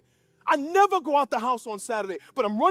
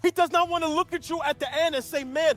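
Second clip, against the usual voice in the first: full-force preaching with raised pitch; impassioned preaching; 4 semitones higher, 5 dB louder than usual. Acted. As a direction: preach it in long, rising, emphatic phrases. Preaches at full force, voicing God.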